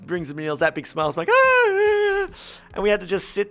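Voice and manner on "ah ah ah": high, strained awkward laugh; a squeaky nervous ah ah; mock strained laugh. Awkward waitress moment retold.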